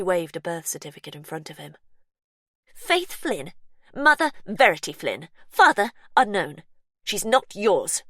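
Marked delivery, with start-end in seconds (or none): 2.7-8.0 louder, jabbing each entry out; angry reading. Acted. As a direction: read it like a verdict. Sister reads the certificate furiously.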